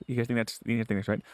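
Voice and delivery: ordinary talk, nothing marked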